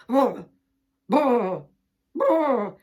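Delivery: loud blubbering brr; upset hen sobbing. Acted. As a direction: splutter like a crying hen. Hen upset, can't stop crying.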